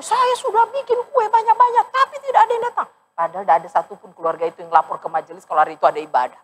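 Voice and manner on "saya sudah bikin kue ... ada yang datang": high, complaining hostess voice; aggrieved family member. Retold or imitated. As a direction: complain like a hurt host whose cake went uneaten. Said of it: "Jadi saya didatang". (keluarga yang tersinggung mengeluh dengan nada tinggi)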